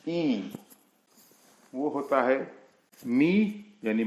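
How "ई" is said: ई drawn out long; lengthened vowel. Shown as a demonstration. Teacher demonstrating the long sound, ई sustained. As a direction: hold ई as a long "ee". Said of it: "ई लंबी करके".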